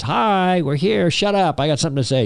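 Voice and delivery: high-pitched mock flight attendant. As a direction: imitate a cabin announcement, pitched well up. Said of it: "the flight attendant says".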